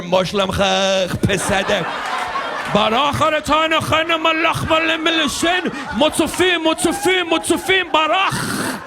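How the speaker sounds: hammed-up Hebrew-sounding gibberish; mock Hebrew. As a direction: rattle it off like a prayer chant.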